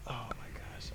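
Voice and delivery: soft breathless whisper. Real, not acted. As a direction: drop to a whisper. Sudden whispering aside.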